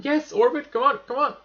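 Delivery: loud high urging cheer; excited cheering. Real, not acted. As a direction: shout it on, thrilled and frantic. Nearly reaching orbit.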